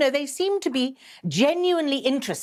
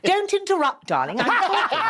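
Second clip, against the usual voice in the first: mock scolding; 8 semitones higher than usual. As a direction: put him in his place, theatrically.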